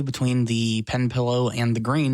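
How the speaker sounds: usual speaking voice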